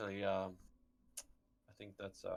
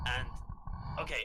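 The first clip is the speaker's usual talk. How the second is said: ordinary talk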